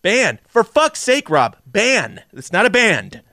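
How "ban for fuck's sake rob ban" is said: BAN punched high, exasperated; exasperated correction. Real, not acted. Co-host setting the host straight on a misheard word, exasperated stress on "Ban".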